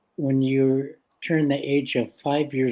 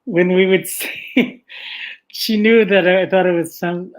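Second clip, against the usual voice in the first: laughing while talking; 5 semitones higher, 7 dB louder than usual. Real, not acted.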